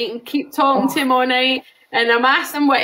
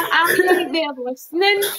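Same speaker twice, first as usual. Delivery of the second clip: laughing quote; 5 semitones higher than usual. Retold or imitated. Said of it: "He says".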